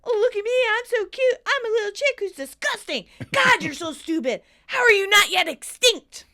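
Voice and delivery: high, loud disgusted tirade; unleashing hell. Acted. Tommy yelling at the chick that pooped, hammed-up rage. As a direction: rant furiously at a tiny pest. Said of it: "Then he unleashes hell".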